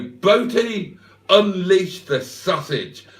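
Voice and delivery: high, mock-dramatic order, slowing down; hammed-up, drawn-out call to action; imagined lover's command. Lover in bed orders her man to unwrap the food, mock-dramatic.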